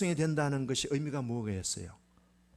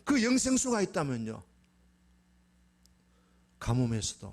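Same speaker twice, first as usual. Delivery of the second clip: ordinary talk, nothing marked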